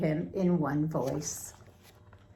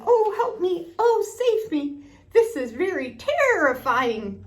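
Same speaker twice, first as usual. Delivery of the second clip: much higher and louder panicky cries; mock frightened shout; 10 semitones higher, 5 dB louder than usual. A narrator's reading. Small scientist fleeing the monster, high mock-panicked shouts. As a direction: squeal for help like a tiny scared scientist.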